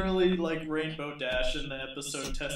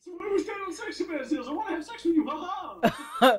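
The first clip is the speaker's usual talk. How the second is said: very fast, high-pitched rush; Speed Racer imitation; 12 semitones higher than usual. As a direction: gabble it high, ending in a mock laugh. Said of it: "Stop being Speed Racer about it".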